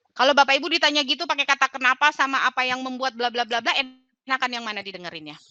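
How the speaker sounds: ordinary talk, nothing marked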